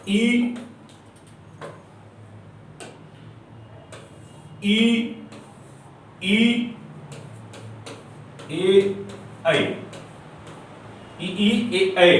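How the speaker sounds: slow isolated vowels ई, ई, ए, ऐ, each held apart; vowel demo. Shown as a demonstration. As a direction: sound out ई ए ऐ one by one for the class. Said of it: "आप उच्चारित करिए इन चार".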